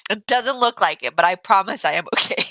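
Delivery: normal talking voice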